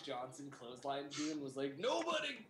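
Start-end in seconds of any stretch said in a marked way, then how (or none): 1.8-2.5 high mock wrestler yell; hammed-up wrestler shout; The Rock impression. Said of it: "I have a bad impression of The Rock".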